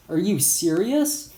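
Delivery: a touch of disbelief, voiced lightly; shocked. Eugene reacts to robbery plan, shocked. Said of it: "Eugene said in this shocked voice".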